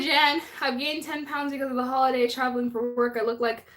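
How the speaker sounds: ordinary talk, nothing marked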